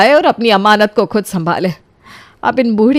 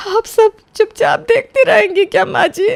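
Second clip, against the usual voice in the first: high, sobbing plea; crying out; 11 semitones higher than usual. Acted. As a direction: break down while asking. बहू रोते हुए पूछती. (रोती हुई ऊँची आवाज़ में सवाल)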